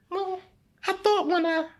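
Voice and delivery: high incredulous excuse; defensive protest. Retold or imitated. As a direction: protest your innocence to God. Defensive reply to God retold.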